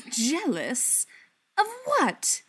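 sharp, high incredulous demand. A narrator's reading. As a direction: throw the question back hard. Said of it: "Beckett demanded".

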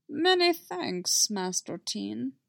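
drawn-out little Jedi master voice; Yoda voice. Acted. Yoda thanking Master Teen, slow creaky voice.

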